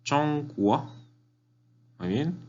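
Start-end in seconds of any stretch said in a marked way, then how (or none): 0.0-0.9 "Chongguo" high and level, then rising; repeat-after-me "Chongguo" with exaggerated tones; tone model for repetition. Shown as a demonstration. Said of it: "solo repite conmigo".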